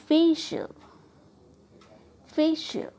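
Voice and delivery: Facial with FE punched high; syllable stress. Shown as a demonstration. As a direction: hit 'फे' in facial, let the rest drop. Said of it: "आपको कैसे syllable को trust". (Facial में 'फे' पर ज़ोर)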